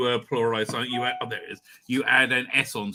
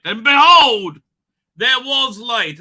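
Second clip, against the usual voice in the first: louder, higher, slow solemn behold; biblical proclamation; 8 semitones higher, 12 dB louder, slower than usual. Acted. Quoting Genesis to the audience, loud, slow and solemn. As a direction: intone it like scripture.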